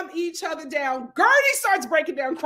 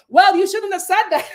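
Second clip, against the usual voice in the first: loud, higher-pitched imitation of a telling-off; hammed-up shouted rebuke; mock scolding; 5 semitones higher, 7 dB louder than usual. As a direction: burst out with the reprimand, nearly laughing. Imitating Alexia scolding Lisa.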